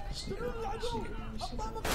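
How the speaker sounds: hushed, very soft whispering; sneaky whisper. Real, not acted. Gamer creeping around the enemy, whispering to himself. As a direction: whisper it as if creeping past someone.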